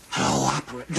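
low, croaky whispered greeting; hoarse false start. Acted. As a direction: croak into the phone as if the voice were lost. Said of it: "he has laryngitis".

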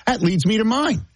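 high pitch with a laugh in it; high register. Pretend. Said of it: "still gotta go high register".

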